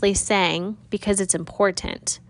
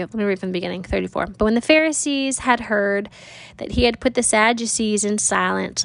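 usual speaking voice

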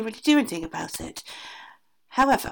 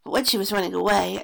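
plain reading voice

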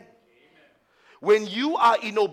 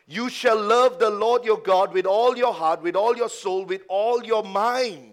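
louder, booming scripture quote; preaching scripture; 2 semitones higher, 7 dB louder than usual. Acted. Preacher booming scripture to the church, forceful. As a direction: thunder the verse at the congregation.

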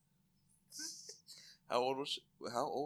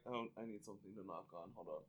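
hushed aside; 5 semitones lower, 16 dB quieter, faster than usual. Retold or imitated. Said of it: "it's just like".

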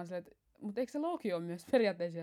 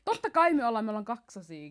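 ordinary talk, nothing marked

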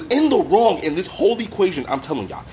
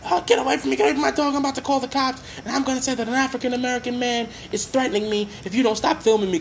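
high-pitched mock complaint; higher-voiced mimicry of an indignant dog walker; mad woman, imitated; 7 semitones higher, faster than usual. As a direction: imitate an angry woman threatening to call police. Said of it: "she gets mad. And she says".

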